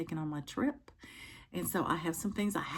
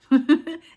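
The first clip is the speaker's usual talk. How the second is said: sudden high burst of laughter; bright laugh; 10 semitones higher, 16 dB louder than usual. Real, not acted. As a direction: laugh at yourself. Laughs at own sewing skill.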